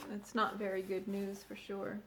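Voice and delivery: ordinary delivery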